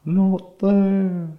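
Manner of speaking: high, drawn-out slow-motion words; mock slow motion. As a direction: stretch the words as if slowed down. Joins the slow-motion bit.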